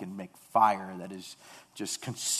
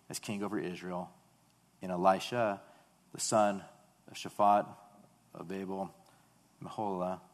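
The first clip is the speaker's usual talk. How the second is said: soft, lower, reading God's commands; quiet; 3 semitones lower, 6 dB quieter than usual. A narrator's reading. God quietly sending Elijah, soft and low. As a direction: speak it gently and low. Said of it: "so quietly just says to him".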